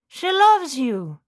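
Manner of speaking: talking dog. Acted.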